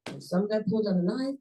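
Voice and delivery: ordinary delivery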